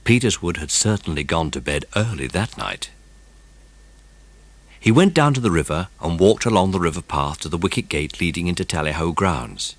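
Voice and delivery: usual speaking voice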